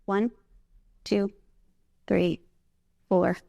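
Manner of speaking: slow, calm counting of the breath; steady instructor counting breaths; guided breathing count. Shown as a demonstration. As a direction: lead the room through a slow inhale. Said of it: "take a deep, slow breath in, five counts".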